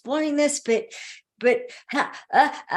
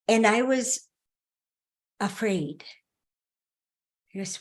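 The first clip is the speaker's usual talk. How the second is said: ordinary talk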